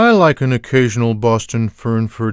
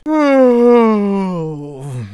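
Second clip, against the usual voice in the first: long high sigh; 7 semitones higher than usual. Acted. Weary sigh alone.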